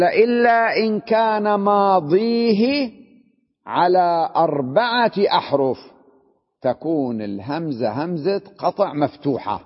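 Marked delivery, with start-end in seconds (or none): 0.0-5.8 slow, measured quote from the book; reading the text aloud. Retold or imitated. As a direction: read the rule slowly and carefully. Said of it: "قال". (قراءة بطيئة لنص القاعدة)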